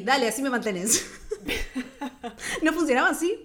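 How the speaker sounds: laughing while joking; giggling high through the words. Real, not acted.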